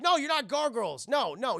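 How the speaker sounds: loud high protesting "no"; insistent. Retold or imitated. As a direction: push back hard. Character correcting them.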